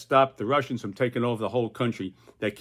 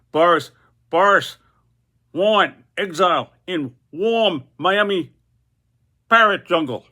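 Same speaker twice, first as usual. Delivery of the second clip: parrot voice; 7 semitones higher, 8 dB louder than usual. Acted. Boris the parrot speaks.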